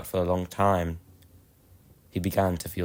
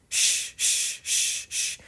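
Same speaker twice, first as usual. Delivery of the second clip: whispered shh puffs fading softer; steam puffing sounds; 5 dB quieter, much slower than usual. Acted. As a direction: puff like a steam engine pulling away. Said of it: "Edward puffed away".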